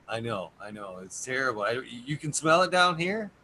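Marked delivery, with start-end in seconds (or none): 1.6-3.3 high and loud delight; thrilled, laughing surprise; excited. Real, not acted. Delighted at the smell news.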